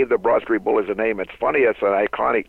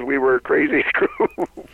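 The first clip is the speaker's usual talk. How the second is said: laughing; 7 semitones higher, slower than usual. Real, not acted. Laughing at team memory.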